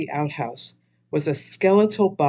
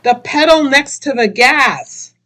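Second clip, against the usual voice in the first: shouting; 10 semitones higher, 10 dB louder than usual. Acted. Donnie shouting instructions.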